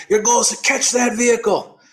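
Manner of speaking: high, emphatic Australian coach; imitated Aussie pep talk; Australian accent. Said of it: "You're Australian?".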